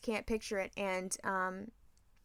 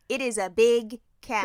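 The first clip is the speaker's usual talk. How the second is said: slow, loud read-aloud; beginning reader; 9 dB louder than usual. Retold or imitated. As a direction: read it out laboriously, word by word. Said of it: "And then she'll read".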